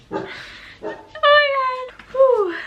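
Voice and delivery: high, loud squealing outburst; excited squeal. Real, not acted. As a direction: shriek with delight at the plot twist. Reacting to a big plot moment.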